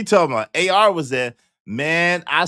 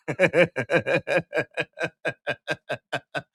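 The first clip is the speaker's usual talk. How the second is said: long rolling real laugh, softer; 5 dB quieter than usual. Real, not acted. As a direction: let a long laugh roll out. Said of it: "long laugh".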